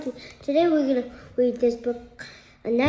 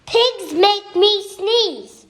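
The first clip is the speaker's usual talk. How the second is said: higher, louder and slow; loud title read; 7 semitones higher, 7 dB louder than usual. Acted. Child reads the title aloud to the camera, loud and slow. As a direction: announce the title proudly.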